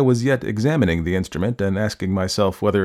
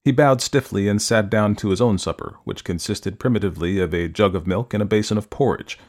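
ordinary delivery

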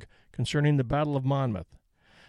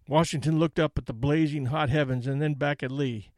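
plain reading voice

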